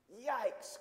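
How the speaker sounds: high, startled story-character yelp; startled cry. Acted. Reader voices Carlo spotting an alligator, startled cry. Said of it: "cried Carlo".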